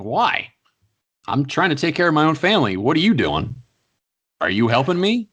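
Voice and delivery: venting at an imagined critic; mock rant. Ranting at critics, voiced retort.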